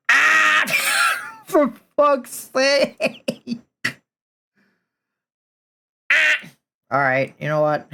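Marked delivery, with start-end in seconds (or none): 0.1-0.7 frustrated scream. Real, not acted. Rage at drawing mistake. 0.7-1.4 strained cry-shout. Real, not acted. Rage at drawing mistake. 1.5-3.6 high, slow, drawn-out curse; panicking gamer. Real, not acted. Rage at drawing mistake. 6.1-6.4 frustrated scream. Real, not acted. Rage at drawing mistake.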